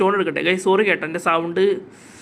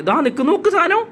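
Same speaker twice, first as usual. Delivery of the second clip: there it is, point it out eagerly; excited spotting; 7 semitones higher than usual. Real, not acted. Gamer spotting the raptor for viewers, excited and high. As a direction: pitch jumps much higher at the find.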